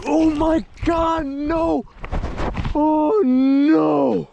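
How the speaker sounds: high, loud cry of dismay; real anguished shout. Real, not acted. Angler cries out as a giant bass comes unhooked, loud and high. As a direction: yell it as the big fish gets away. Losing a huge fish.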